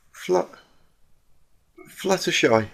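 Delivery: breathless choked words; choking gasp. Acted. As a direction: choke out the name. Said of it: "she coughed, gasping for breath".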